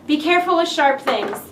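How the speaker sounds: ordinary talk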